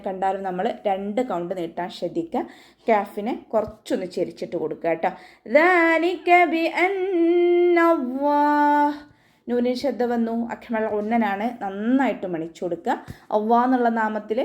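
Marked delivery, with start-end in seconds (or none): 5.6-8.9 slow, high, melodic tajweed chant; Quran recitation. Shown as a demonstration. Teacher chants the verse for students, slow and melodic. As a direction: draw each syllable out in a sung recitation.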